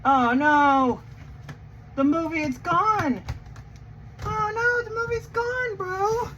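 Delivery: high, hammed-up panic; mock panic. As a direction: cry out in fake horror. Mock panic over missing movie.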